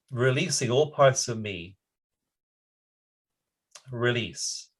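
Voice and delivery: gentle release meditation voice; guided meditation. Acted. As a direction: breathe each phrase into calm. Leads tapping meditation.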